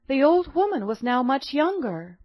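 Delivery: usual speaking voice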